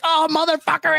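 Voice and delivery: high pitched mock yell at the TV. Fan yelling at the TV over the game, mock yell. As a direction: yell at the screen, hammed up. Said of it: "yelling at a T V like".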